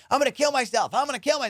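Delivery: high mock wail of a waiting-room patient; crazy person. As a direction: imitate someone losing it, high. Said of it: "crazy people just going".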